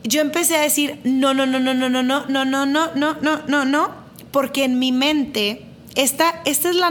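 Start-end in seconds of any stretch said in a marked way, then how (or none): 1.0-3.9 retold panic. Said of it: "el miedo se apoderó de mí".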